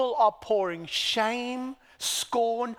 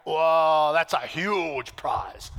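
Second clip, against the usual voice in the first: mock awe; 6 semitones lower, 4 dB louder, slower than usual. Mock amazement at prize.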